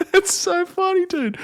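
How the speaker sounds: high squealing laugh through the words; cracking up, voice jumps way up. Real, not acted. Bursts out laughing at clip.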